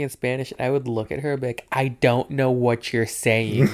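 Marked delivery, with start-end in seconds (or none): 1.7-3.7 slow, spelled-out frustrated reply; retold exasperation. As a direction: say it slowly, word by word, to someone not understanding. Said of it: "yelling at me".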